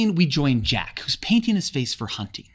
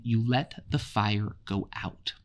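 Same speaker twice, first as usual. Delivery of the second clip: low, softer, slow reproach; quiet anger; 4 semitones lower, 5 dB quieter, slower than usual. A narrator's reading. Ralph reproaching Jack, quiet anger. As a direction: hold the anger back. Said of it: "in his anger keeps repeating".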